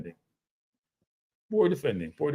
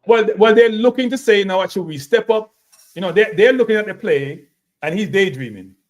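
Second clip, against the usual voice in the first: loud excited rant; 4 semitones higher, 6 dB louder than usual. Real, not acted. Arguing over defending.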